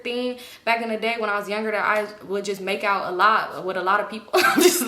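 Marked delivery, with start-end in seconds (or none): 4.3-4.8 bursting into a laugh. Real, not acted. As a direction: laugh out the words. Laughs at own confession.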